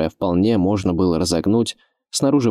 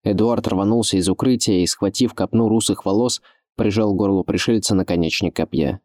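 usual speaking voice

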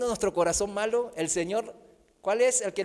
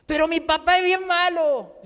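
mock whining complaint; 8 semitones higher, 5 dB louder than usual.

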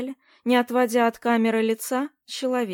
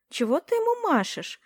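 high-pitched, uneasy question; a touch of alarm as you ask; worried; 8 semitones higher than usual. A narrator's reading. Vera questions Mikki waving at a stranger, worried. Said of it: "забеспокоилась Вера".

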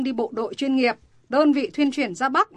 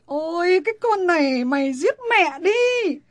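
rising into an anguished scold, louder and higher; exasperated outburst; 6 semitones higher, 4 dB louder than usual. Acted. Mother despairing at her daughter's refusal, exasperated cry. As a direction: wail at the stubborn child.

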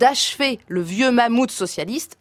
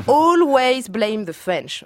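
put-on slow, louder British accent; 4 semitones higher, 4 dB louder, much slower than usual. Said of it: "Vous remarquerez mon accent britannique". (accent britannique lent et appuyé)

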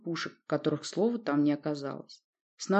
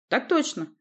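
crisp military reply; 8 semitones higher than usual. A narrator's reading. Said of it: "рыжий бородач кивнул".

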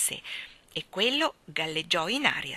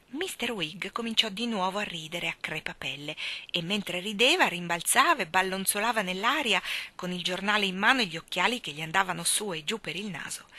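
ordinary delivery, nothing marked

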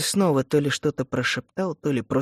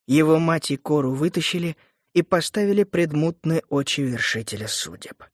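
ordinary delivery

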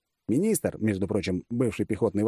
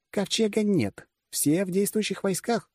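higher-pitched voice of a blunt woman commander; female generalissimus; 12 semitones higher, slower than usual. Acted. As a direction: explain it in the commander's high voice. Voices Gadya. (высокий голос женщины-генералиссимуса)

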